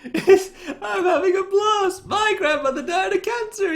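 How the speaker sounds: high, loud words through laughter; laughing. Real, not acted. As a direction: shout it out while cracking up. Quoting donation while laughing.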